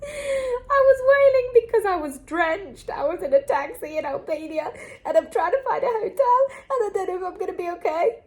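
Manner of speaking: tearful high wail through a laugh; crying. Real, not acted. Telling of the taxi in Albania, real crying. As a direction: let the tears break through the words. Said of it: "I started wailing".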